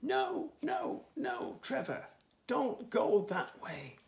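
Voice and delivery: high, loud megaphone call, slowing at the end; bellowed. A narrator's reading. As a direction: holler a warning through a megaphone. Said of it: "Stig bellowed into his megaphone".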